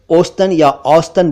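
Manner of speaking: "औसतन" run together as "ostan", then "austan"; two wrong forms. Shown as a demonstration. Teacher shows both mistakes, two syllables. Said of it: "दोनों ही गलत हैं".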